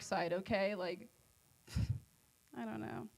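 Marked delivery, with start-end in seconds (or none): none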